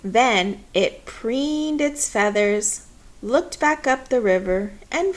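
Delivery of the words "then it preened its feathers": ordinary delivery, nothing marked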